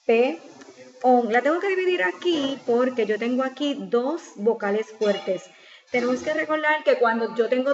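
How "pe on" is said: isolated syllables, pauses between; sílaba por sílaba. Shown as a demonstration. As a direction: split the word into three separate beats. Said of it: "la voy a dividir en sílaba". (separando en sílabas)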